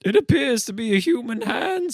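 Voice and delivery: put-on high grandiose Gothic English accent. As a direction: report the hand in a pompous Gothic English accent. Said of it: "sort of grandiose Gothic English accent".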